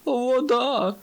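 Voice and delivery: high-pitched drawn-out cry; screaming. A narrator's reading. Friends plunging toward the lake, screaming. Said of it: "репетували Думдідум".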